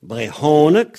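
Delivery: "Brehonek" loud on the breathed HO; "Brehonek", middle stressed with plain h; aspirated H. Shown as a demonstration. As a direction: punch the h syllable in "Brehonek". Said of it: "c'est un H".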